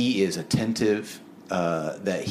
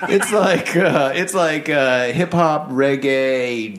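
high, loud, still laughing; talking through laughter; 5 semitones higher, 5 dB louder, slower than usual. Real, not acted. Co-host recovering from a Shaggy joke, laughing loudly. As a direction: laugh your way back into the point.

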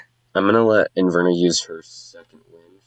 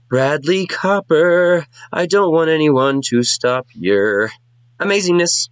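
much higher, slow, adoring; high sing-song bard; 9 semitones higher, slower than usual. Acted. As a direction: lay the flattery on thick.

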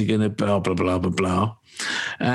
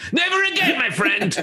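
high-pitched shout, dropping on the last words; mock indignant shout; 14 semitones higher than usual. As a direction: vow it like an exasperated commuter. Acted comic outburst on the train.

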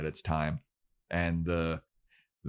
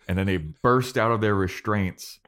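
ordinary talk

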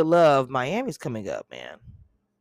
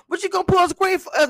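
high, loud quoted host voice; playful high-pitched imitation; mimicking the host; 7 dB louder than usual. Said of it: "she said".

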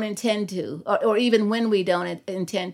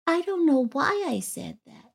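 put-on a touch of a sad little boy, higher and softer; mildly sad child; 6 semitones higher, 5 dB quieter than usual. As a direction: say it like a small boy who feels bad. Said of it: "looked at me with this really sad face".

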